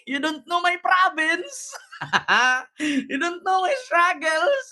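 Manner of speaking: high-pitched imitation of an aggrieved woman; mock protester. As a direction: mimic her pleading with police, laughing near the end. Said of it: "Parang si Sarah lang to". (panggagaya sa babaeng naghihinanakit, mataas ang boses)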